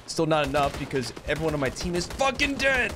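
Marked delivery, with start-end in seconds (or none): 1.3-2.9 exasperated, rising to a high outburst; mad. Real, not acted. Gamer complaining about dead team, mad. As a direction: build to a high, angry yell on the last words. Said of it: "I'm not mad, you're mad".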